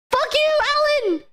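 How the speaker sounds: high-pitched squirrel talking back; high, mock-angry meme squirrel; squirrel meme impression. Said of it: "the other squirrel is like".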